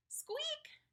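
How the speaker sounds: very high, soft squeak; a tiny frightened squeak; little squeak. Acted.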